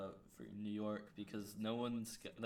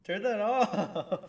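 high loud yelling; 11 semitones higher, 7 dB louder, slower than usual. Real, not acted. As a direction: shout at the noise, slowly. Shouting at game audio.

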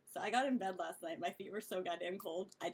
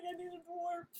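higher, softer, pleading excuse; mock apologetic plea with a laugh; whiny plea; 10 semitones higher, 4 dB quieter than usual. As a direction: whine it like a guilty kid. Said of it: "I'm just like".

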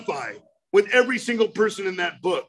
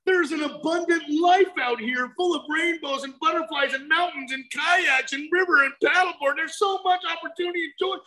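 calling out; 6 semitones higher than usual. Retold or imitated. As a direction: yell to a friend stuck inside. Passersby calling into the cave.

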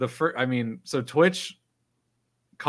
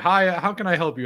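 bright, raised helpdesk greeting; mock phone-support cheer; cheery helpdesk greeting; 8 semitones higher, 6 dB louder than usual. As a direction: chirp it like a support agent. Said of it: "And she's like".

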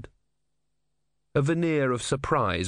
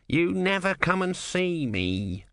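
high, slow tortoise grumble; complaining tortoise; 8 semitones higher, slower than usual. A narrator's reading. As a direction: whine like a neglected little god. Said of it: "said the tortoise".

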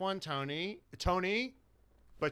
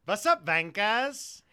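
drawn-out, higher show opener; hyped intro greeting; 6 semitones higher, 6 dB louder, slower than usual. Real, not acted. Host greeting the audience at the start, slow and hyped. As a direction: stretch it out like a host kicking off the show.

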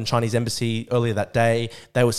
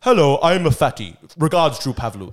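mock troll email; 3 semitones higher, 6 dB louder than usual. Troll impersonating him by email, read mockingly. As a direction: read the fake email like a taunt. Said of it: "fake Drew Pavlu email and be like".

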